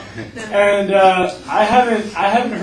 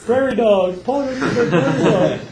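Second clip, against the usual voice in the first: laughing mock despair; 6 semitones higher, 3 dB louder than usual. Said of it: "Or whatever".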